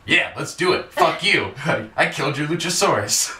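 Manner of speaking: mock defiance, tossed out with a laugh; cocky Johnny. Retelling a wrestler's brash answer to a dare, cocky. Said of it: "So then Johnny's just like".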